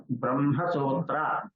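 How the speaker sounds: Brahma sutra said slowly, Brahma as bramha; correct m-h order. Shown as a demonstration. Teacher gives a further example, carefully drawn out. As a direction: say "Brahma sutra" with m held before ha. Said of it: "So ma has to come to be pronounced".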